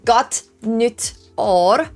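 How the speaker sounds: Gott nytt år said very slowly with a rising end; drawing out Gott nytt år word by word; slow Swedish Gott nytt år for the chat; Swedish greeting. Shown as a demonstration. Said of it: "And then in Swedish".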